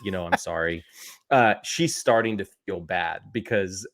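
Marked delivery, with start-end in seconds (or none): 0.0-0.8 softer, gentle and sincere; sincere apology. Real, not acted. Husband apologizing to his wife if she listens, softly. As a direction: say sorry to her softly, meaning it.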